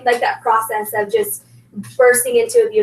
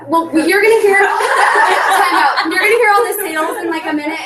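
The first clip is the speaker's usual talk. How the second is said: laughing; 4 semitones higher, 3 dB louder than usual. Real, not acted. Teasing guest, laughing through words.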